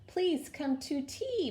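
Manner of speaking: a hint of a high spider voice calling. A narrator's reading. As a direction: call out the invitation. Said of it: "Miss Spider cried".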